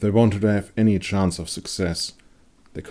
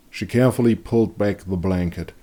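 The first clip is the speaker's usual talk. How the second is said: ordinary delivery, nothing marked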